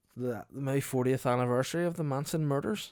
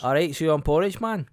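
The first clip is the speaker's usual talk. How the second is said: loud, higher brusque cafe worker voice; cafe lady; 6 semitones higher, 9 dB louder than usual. Retold or imitated. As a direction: bark it like a gruff Edinburgh cafe lady.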